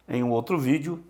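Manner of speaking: ordinary talk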